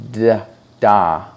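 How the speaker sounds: "d", a pause, then "da", consonant before the vowel; sound then vowel. Shown as a demonstration. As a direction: sound out "d" first, then blend it into "da". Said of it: "First you make the D sound and then the A sound".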